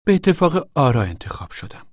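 mildly self-satisfied pride; proud smile. A narrator's reading. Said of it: "لبخندی غرورآمیز زد".

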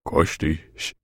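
low, hushed, gentle question; soft question. A narrator's reading. Father gently asks his son whether he killed him, softly. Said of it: "به نرمی پرسید".

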